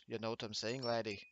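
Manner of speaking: a clumsy, half-hearted Irish-or-Scottish brogue; bad Irish accent. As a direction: toss it off in a lightly put-on Irish lilt. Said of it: "terrible Irish imitation".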